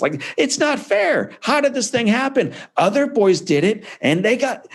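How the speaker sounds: put-on high, whiny put-upon kid; childish wail of unfairness; self-pitying victim. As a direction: whine like life is unfair to you. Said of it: "I write it as a total victim".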